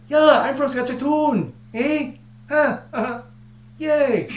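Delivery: very high, mocking Canadian voice; high-pitched Saskatchewan accent. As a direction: squeal like a caricature Saskatchewan Canadian. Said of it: "They're so high-pitched".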